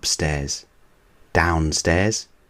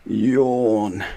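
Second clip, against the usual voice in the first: yawn; 4 semitones higher than usual. Acted. Sleepy parents.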